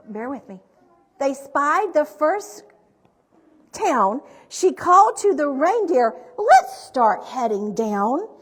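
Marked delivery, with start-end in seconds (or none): 1.2-8.3 projected, rhyming picture-book narration; story reading to children. A narrator's reading. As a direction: read the rhymes out with big, deliberate emphasis.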